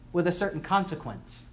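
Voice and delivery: ordinary talk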